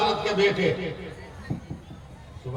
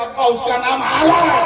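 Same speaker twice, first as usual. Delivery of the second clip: higher, much louder punchline cry; loud proclamation; 8 semitones higher, 10 dB louder than usual. Retold or imitated. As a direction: proclaim the answer with an open throat. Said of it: "जोर से बोलो".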